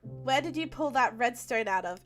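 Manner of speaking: ordinary talk, nothing marked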